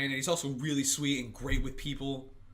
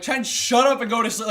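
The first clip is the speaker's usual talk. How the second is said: angry retort; 5 semitones higher, 6 dB louder than usual. Retold or imitated. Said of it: "like, really angry".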